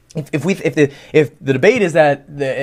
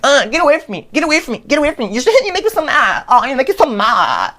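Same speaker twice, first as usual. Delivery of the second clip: much higher, whiny, hammed-up pleading; mock whiny victim; 10 semitones higher than usual. Victim whining at the one hitting him, hammed-up. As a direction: play the hurt victim, overdone.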